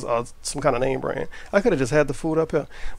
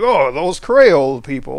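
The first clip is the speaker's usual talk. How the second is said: louder, drawn-out mimicry of an outsider; slow, mocking put-on voice; 5 dB louder, slower than usual. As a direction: imitate a clueless online dude, Creole as CRAY-ole. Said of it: "some dude in fucking Dakota be like".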